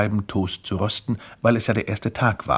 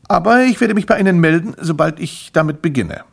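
high, loud, keen promise; eager salesman; 6 semitones higher, 6 dB louder than usual. Acted. Grimpel promises the man evening toast, eager salesman voice. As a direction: assure the customer like a zealous representative.

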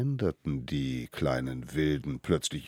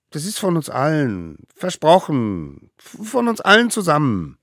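earnest promise; 12 semitones higher, 9 dB louder than usual. Acted. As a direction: swear it eagerly, pleading. Little ones promise, begging mammoth. (hoch und inständig beteuert)

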